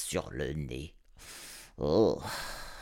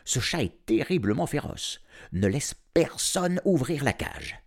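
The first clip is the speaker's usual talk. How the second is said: mise en garde; 7 semitones higher than usual. A narrator's reading. Le vétérinaire met en garde.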